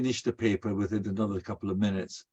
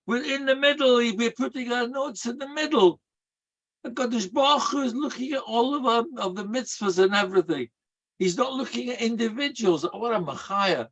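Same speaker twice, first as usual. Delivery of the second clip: high, rising preacher's fervour; preaching at full force; 11 semitones higher than usual. Acted. Rabbi preaching the lesson to the congregation, fervent and high. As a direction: lift the voice and preach it out.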